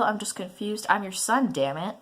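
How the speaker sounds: ordinary talk, nothing marked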